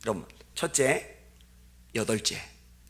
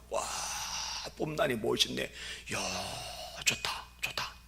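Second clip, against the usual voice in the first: admiring whisper; 9 dB quieter than usual. Retold or imitated. Tourist admiring temple, whispered.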